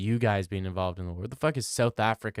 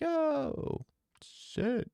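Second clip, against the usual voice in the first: much higher, softer mock surprise; mock woman's voice; 6 dB quieter than usual. Imitating the girl reacting, high and soft. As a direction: squeak it like a startled girl. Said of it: "She's like".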